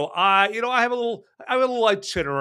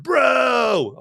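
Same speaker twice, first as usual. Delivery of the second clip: "bro" shouted high and loud; mock teen "bro"; 10 semitones higher, 5 dB louder than usual. Mocking Cameron's idea of teen slang, high loud "bro". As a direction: throw "bro" out like a surfer kid. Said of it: "always use the word".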